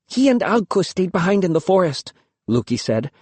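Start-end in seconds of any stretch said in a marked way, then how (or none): 0.1-2.1 put-on young girl voice. A narrator's reading. Girl Luki answers father.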